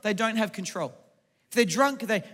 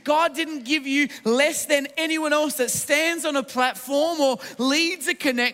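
raised, driving sermon delivery; rousing preaching; 3 semitones higher than usual. Real, not acted. Preacher telling strugglers God gave them no less, rousing preaching. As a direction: preach it out to the whole room with building conviction.

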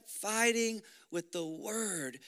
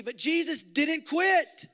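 loud, higher preaching climax; rousing preacher at full force; 3 semitones higher, 4 dB louder than usual. Acted. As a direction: hammer the victory home.

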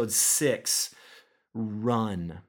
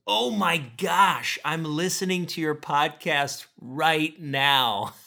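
a touch of delighted surprise, higher and slower; excited fan; 6 semitones higher, 4 dB louder than usual. Retold or imitated. A friend recognises him mid-run, mildly imitated excitement. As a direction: lightly imitate a friend's thrilled gasp. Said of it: "she stops and looks at me and goes".

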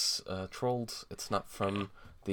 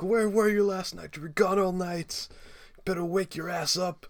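angry screaming; 10 semitones higher than usual. Acted. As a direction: shriek it at him, pitched way up. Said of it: "screaming at Porky".

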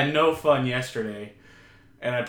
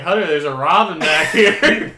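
laughing; 3 semitones higher, 9 dB louder than usual. Retold or imitated. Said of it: "and be like".

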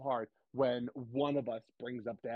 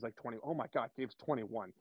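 ordinary talk, nothing marked

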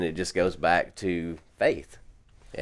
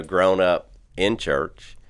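ordinary talk

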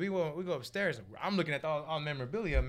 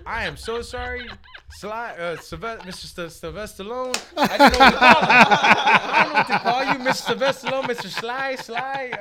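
loud, cracking up, fumbling his name; laughing flustered apology; 5 semitones higher, 11 dB louder than usual. Retold or imitated. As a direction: blurt it out laughing.

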